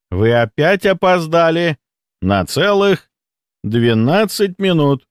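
slow, lofty scolding from a superior; pointed reproach. A narrator's reading. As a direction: savour each word of the reprimand. Hostile superior faults late doctor.

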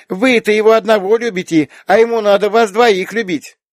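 put-on high, loud childish protest; small girl arguing hotly, pitched high; indignant little girl. A narrator's reading. Little girl argues papa must love two, indignant. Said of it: "возмутилась девочка".

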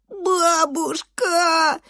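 high, very drawn-out whine; wailing child. A narrator's reading. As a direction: wail for grandma, stretching every vowel. Hit boy wailing for grandmother. (жалобный протяжный детский вой)

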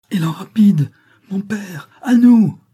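rising to a high loud cry; urgent call. A narrator's reading. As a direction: call out for rescue. Calling to father for help.

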